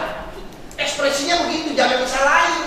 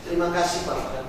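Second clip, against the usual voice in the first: flat mumble; 6 semitones lower, 9 dB quieter than usual. Acted. Flat second child, no expression.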